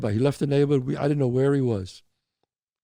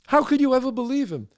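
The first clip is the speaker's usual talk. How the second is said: the same question pitched higher, HOW leading; more incredulous; 8 semitones higher than usual. Acted. Asking the wife again, higher and more astonished.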